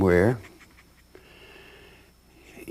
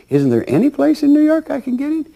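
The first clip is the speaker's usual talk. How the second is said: pleading; faster than usual. Retold or imitated. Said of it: "you say".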